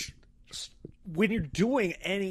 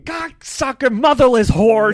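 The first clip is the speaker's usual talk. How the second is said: high, very loud outburst; screamed cursing; 9 semitones higher, 12 dB louder than usual. Retold or imitated. Dad when the remodeling goes wrong, imitated screamed cursing. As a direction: explode in imitation of an enraged scream. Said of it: "he would just be screaming".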